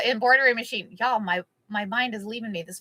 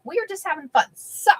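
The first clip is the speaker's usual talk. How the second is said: ordinary talk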